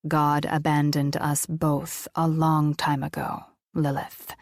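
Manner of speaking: solemn deep voice of an angel. Acted. Angel dismisses her faith.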